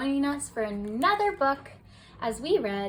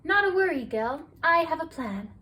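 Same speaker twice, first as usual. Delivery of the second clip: higher, bright and confident; cheerful reassurance; 5 semitones higher, 4 dB louder than usual. A narrator's reading. Lovelock reassuring Gil she has a plan, cheerful. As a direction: promise him it's handled.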